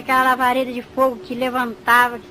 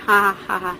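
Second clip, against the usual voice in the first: low, mocking "ha ha ha"; imitated devil's laugh; 4 semitones lower than usual. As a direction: laugh deliberately like a taunting devil. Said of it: "aquele diabo que tava me mostrando já falou assim". (risada debochada de diabo, imitada)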